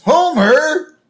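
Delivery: high, loud exclamation of a name; calling out sharply. Retold or imitated. Police wife catching her husband breaking the law, calling out. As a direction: call out to your husband in dismay. Marge spots and calls out Homer.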